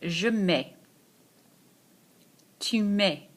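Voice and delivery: teacher modelling verb forms for students to echo; model pronunciation. Shown as a demonstration. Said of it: "Repeat after me".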